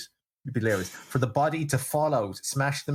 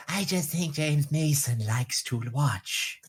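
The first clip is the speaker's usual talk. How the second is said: slow, put-on James Mason accent; James Mason impression; 3 semitones higher, much slower than usual. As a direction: drawl it like James Mason, badly. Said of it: "Terrible accent".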